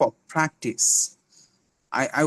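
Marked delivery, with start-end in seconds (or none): 0.0-1.2 প্র্যাকটিস starting like ফ, with a puff; aspirated P. Shown as a demonstration. Teacher correcting the word, aspirated P. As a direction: release air through both lips on প্র্যাকটিস. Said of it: "এটা হবে ফ".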